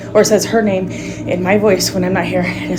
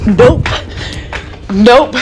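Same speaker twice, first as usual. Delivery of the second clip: panicked refusal; 4 semitones higher, slower than usual. Real, not acted. Mother running from the bedroom, panicked nopes. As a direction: back away in panic. Fleeing the room in fear.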